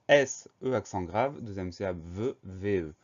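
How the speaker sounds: ordinary talk, nothing marked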